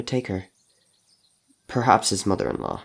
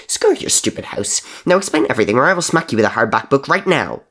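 snarled; 5 semitones higher, 5 dB louder than usual. Acted. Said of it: "Mister Crow snarled".

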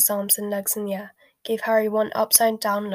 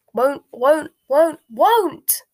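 high elf screech, getting louder; rising scream; 6 semitones higher, 8 dB louder, much slower than usual. A narrator's reading. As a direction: build to a screamed refusal. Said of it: "Creature's voice had risen to a scream".

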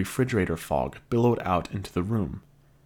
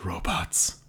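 muttered; 5 dB quieter than usual. A narrator's reading. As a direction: mutter it under your breath.

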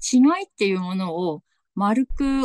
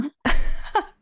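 giggle; 10 semitones higher than usual. Real, not acted. Guest laughs about Tsugaru dialect.

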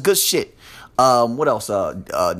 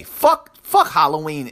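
sudden outburst. Real, not acted. Outburst at losing Saturdays.

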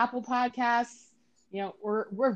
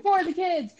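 cheer; 10 semitones higher, 5 dB louder than usual. Acted. Echoing charity slogan.

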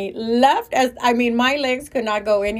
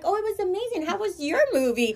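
much higher and softer fake sweetness; professional politeness; 8 semitones higher, 4 dB quieter than usual. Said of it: "networking professionalism kicked in".

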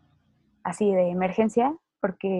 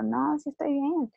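higher, slow, insisting she's fine; stubborn denial; 7 semitones higher, slower than usual. Retold or imitated. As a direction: drag it out like a stubborn kid denying she's sick. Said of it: "Necia, siempre necia". (negación terca, aguda y lenta)

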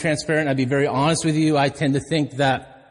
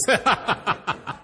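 amused laugh; 4 semitones higher than usual. Real, not acted. Jokes about the doctor's office.

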